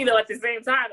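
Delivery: normal talking voice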